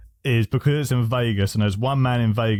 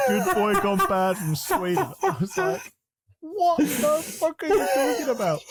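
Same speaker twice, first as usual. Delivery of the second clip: high-pitched, squeezed by real laughter; laughing incredulity. Real, not acted. Co-host mocking the rapper's script, helplessly laughing. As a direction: barely get the words out through giggles. Cracking up retelling the intro.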